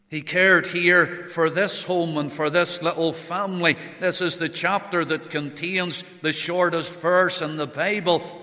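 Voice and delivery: loud, high pulpit delivery; full-force preaching. Acted. Preacher speaking of this grieving family, loud. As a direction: ring it out over the congregation.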